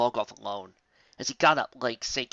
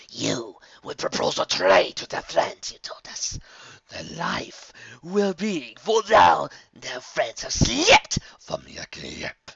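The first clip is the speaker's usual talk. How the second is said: menacing dark whisper; 4 semitones higher, 6 dB louder, slower than usual. Acted. Dark entity scolds servant.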